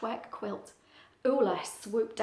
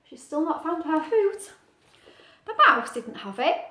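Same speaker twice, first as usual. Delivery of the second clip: ordinary delivery, nothing marked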